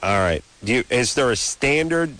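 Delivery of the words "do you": ordinary talk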